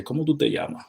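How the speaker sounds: Dominican casual. Shown as a demonstration.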